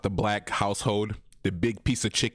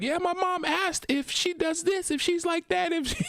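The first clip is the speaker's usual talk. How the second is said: high-pitched mimicry of a daughter; imitated daughter. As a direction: put on a high voice as your daughter reporting back. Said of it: "my daughter comes back and be like".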